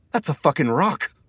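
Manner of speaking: high, indignant outburst; angry realization. A narrator's reading. Emilio realizing he was hit, high angry outburst. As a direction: react in outrage at the discovery.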